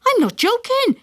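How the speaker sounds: high, insistent dad voice; he means it; father, insistent. A narrator's reading. Father insists to mother: high and insistent.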